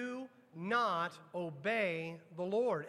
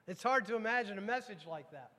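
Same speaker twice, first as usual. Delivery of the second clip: normal talking voice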